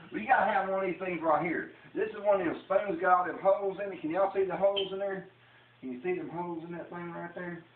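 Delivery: high, loud hammed-up country cook; put-on Southern character. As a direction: play up the Southern cooking-show host. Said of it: "I'm talking like this right here".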